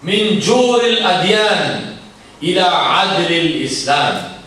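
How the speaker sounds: fast, rhythmic Arabic quotation; rapid Arabic recitation. Acted. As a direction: recite the Arabic in one quick breath.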